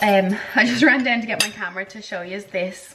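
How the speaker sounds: usual speaking voice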